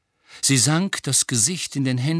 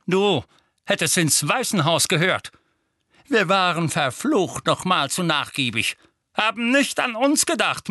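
loud, high, raging shout; furious uncle shouting; 8 semitones higher, 3 dB louder than usual. Acted. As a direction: rant at him, boiling over. Vernon rages at Harry.

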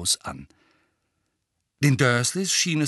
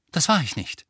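defensive denial, a little higher; weniger gelassen; 5 semitones higher than usual. A narrator's reading. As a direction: deny it, composure slipping. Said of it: "nicht mehr ganz so gelassen". (leicht gereizt abwehrend)

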